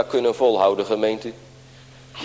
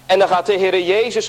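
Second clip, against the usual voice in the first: usual speaking voice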